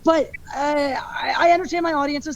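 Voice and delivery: ordinary talk, nothing marked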